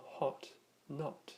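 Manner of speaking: British "hot, not" with a short rounded o; British style accent. Shown as a demonstration. Teacher showing the British spelling with letter O, British short o in "hot, not". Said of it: "more British style usage".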